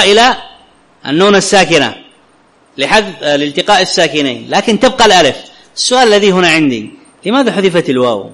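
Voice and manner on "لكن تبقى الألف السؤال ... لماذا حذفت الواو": ordinary talk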